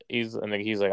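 ordinary talk, nothing marked

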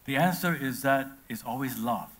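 usual speaking voice

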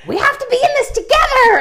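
mom's plea voiced way up high; pleading mom high voice. Imitating mom to daughter on the sidewalk, high pleading voice. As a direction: imitate mom begging her to keep going, high and pleading. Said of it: "she was like".

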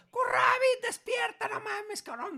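high, loud desperate shout to wake him; frantic yell. Retold or imitated. Father reenacting yelling at his passed-out friend, frantic high shout. Shaking unconscious friend awake.

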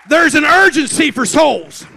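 full-force preaching cry, very loud and high; urgent shout. Real, not acted. Preacher urging the church about souls, urgent shout. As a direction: shout it with urgency to the whole room.

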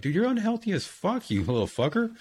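mock scolding. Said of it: "You're like".

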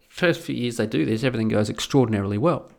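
ordinary delivery

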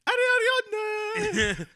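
calling out. Pretend. Calling out like Marco Polo.

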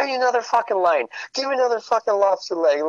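fast high mock whine; frantic Runkle impression. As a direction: rattle it off high like a wired addict. Runkle begging for more cocaine.

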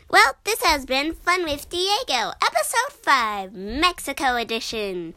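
bright sing-song sign-off; loud, high, singing the goodbye; show outro. Acted.

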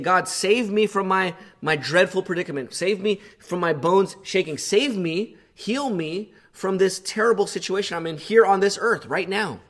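pleading prayer. Acted. Voicing David's desperate prayer.

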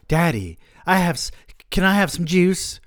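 put-on a small child begging for juice, voice up high; whiny little kid. A narrator's reading.

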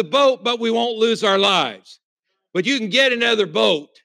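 shouted out to the congregation; hammering the point with a full-voiced shout; preached shout. Real, not acted. Preacher driving the point home.

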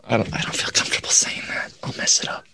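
soft unvoiced whisper. Real, not acted. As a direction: whisper it sheepishly. Whispered bashful aside.

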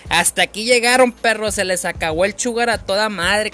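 put-on triumphant kid's battle cry; defiant shout. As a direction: shout down the bullies. Yelling at the neighborhood bullies.

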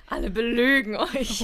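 loud, drawn-out joking proclamation; mock alarmist shout. Playing spoilsport to the children, loud mock shout. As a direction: cry it out like a playful doom-monger.

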